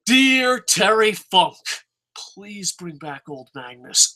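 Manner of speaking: solemn pretend prayer to a wrestling legend; mock prayer. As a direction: pray it solemnly, half in jest. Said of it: "Shall we?".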